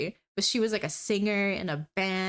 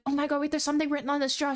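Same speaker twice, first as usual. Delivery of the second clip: fast, higher excited gasp; excited coworker; 5 semitones higher, much faster than usual. Retold or imitated. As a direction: rattle it off like a thrilled coworker. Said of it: "she was like".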